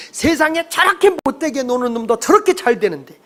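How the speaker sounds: high-pitched indignant grievance, acted out; argumentative complaint. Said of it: "막 이러면서 따지는 인간들이 있어요".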